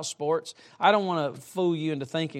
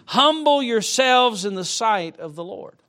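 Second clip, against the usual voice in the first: slow scripture reading; 4 semitones higher, 5 dB louder than usual. Acted. Scripture read slowly.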